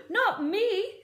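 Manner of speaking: high, loud panicked scream. Acted. Bunce refusing the hole, high scream. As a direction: shriek and run. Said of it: "screamed Bunce".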